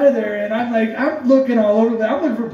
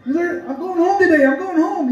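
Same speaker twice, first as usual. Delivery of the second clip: high-pitched excited announcing to everyone; imitating a thrilled, pumped cousin; 7 semitones higher than usual. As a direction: act out an overjoyed inmate telling the whole jail. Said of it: "he's excited. He's, like, so pumped".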